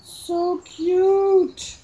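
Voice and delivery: high, drawn-out coo; cooing delight. Real, not acted. Admiring the little envelopes, cooing delight.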